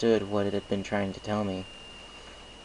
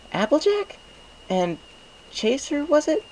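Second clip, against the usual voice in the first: put-on higher young mare, still catching her breath; Twilight Sparkle breathless; 4 dB louder than usual. A narrator's reading. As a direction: ask the names while recovering from a scare. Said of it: "she breathed".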